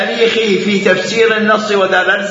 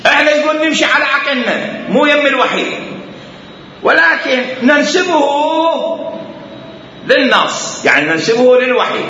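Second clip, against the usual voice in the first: higher-pitched imitation of the opponents; mock opponents' voice; 8 semitones higher than usual. Voices the opponents' deceitful plan. (تقليد ساخر للخصوم بصوت أعلى)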